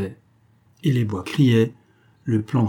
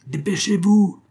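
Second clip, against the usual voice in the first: high-pitched hurrying call; shouted urging; 10 semitones higher, 3 dB louder than usual. A narrator's reading. A hundred voices hurry the major, crying out. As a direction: cry out impatiently from the crowd. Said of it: "cria une voix".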